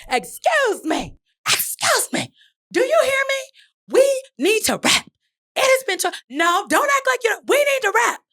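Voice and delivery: very high, drawn-out mock yell; hammed-up insistent interruption at high pitch; re-enacted yelling. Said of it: "so now I'm yelling".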